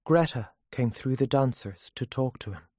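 ordinary delivery, nothing marked

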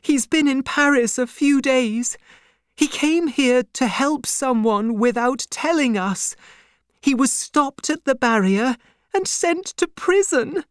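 high, urgent, upset telling; distressed cry. A narrator's reading. Wife tells banker of husband's arrest, distressed. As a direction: pour out the bad news, pitched high. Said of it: "she cried".

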